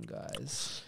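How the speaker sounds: low, soft seductive voice; sexy voice. Pretend. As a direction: purr the word guys. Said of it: "my sexy voice".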